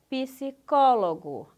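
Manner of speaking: "psicólogo" loud and slow, "ps" kept together at the start; syllable division. Shown as a demonstration. Teacher giving an example of a consonant cluster, syllable division. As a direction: stretch "psicólogo" beat by beat. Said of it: "Não se separa encontros consonantais".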